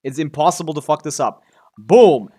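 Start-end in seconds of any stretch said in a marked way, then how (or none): 1.8-2.3 short, loud exclamation; excited shout. Real, not acted. Gamer revealing an upgrade roll, loud shout. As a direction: punch it out as the roll lands.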